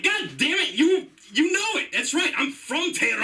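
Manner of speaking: high, exasperated cry of giving up; exasperated outburst. Acted. To the girl pressing him, a mock exasperated confession. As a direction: throw up your hands and finally admit it. Finally gives in to the question.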